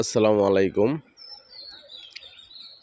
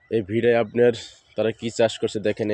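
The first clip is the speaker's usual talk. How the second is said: ordinary talk, nothing marked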